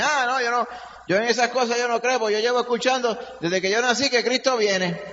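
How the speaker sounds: scoffing skeptic in a high voice; mocking unbeliever. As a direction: mimic a dismissive skeptic. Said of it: "muchas personas dicen".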